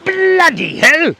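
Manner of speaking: high, slow hammed-up Manchester accent; a loud mock Manchester accent reacting to chat. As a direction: play at a northern English accent, Manchester style. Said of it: "Manchester accent there".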